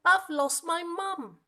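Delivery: put-on slow, high wail of a lost child; sad little monkey. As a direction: cry out for your mum. Monkey cries for mum.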